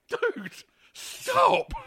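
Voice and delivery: very high, loud shout to stop him; desperate shout. Retold or imitated. Tackling Ruff to stop him.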